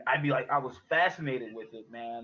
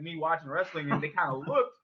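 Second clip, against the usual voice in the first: ordinary delivery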